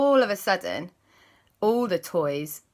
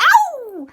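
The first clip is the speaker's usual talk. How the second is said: high loud yelp of pain; puppy yelp; 11 dB louder than usual. Acted. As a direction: squeal like a pinched pup. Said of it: "she went".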